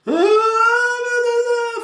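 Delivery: loud, high mock bawling; put-on wail, as if just given a beating; child's tantrum wail. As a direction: imitate a child howling over a denied sweet. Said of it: "faz um escândalo como que tivesse levado uma pisa".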